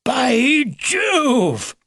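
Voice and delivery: high, loud exclamation; excited shout. A narrator's reading. Old beaver hearing a clever plan, shouting. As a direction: shout it out in delighted surprise. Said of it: "Mister Edgar shouted".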